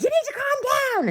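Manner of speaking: very high, loud mock dad voice; mock the father's bossy order; dad telling her to calm down. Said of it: "And he's like".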